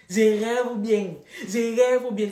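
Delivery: loud, slow, stunned self-talk; can't believe your eyes, out loud; slowly: I must be dreaming; excited disbelief. Retold or imitated. Disbelief at the crush's return.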